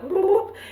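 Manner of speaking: a sudden high whoop; playful high sound effect; whoop noise. Shown as a demonstration. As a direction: yelp it way up high like a sci-fi gadget. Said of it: "I told you that's the noise".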